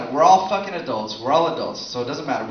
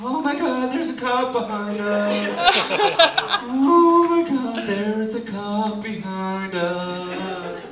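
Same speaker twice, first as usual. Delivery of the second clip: slow, high panicky drawl; mock paranoid stoner; 5 semitones higher, 4 dB louder, much slower than usual. Comic stoned driver spotting a cop, slow high panic. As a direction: dread every word like a stoned driver.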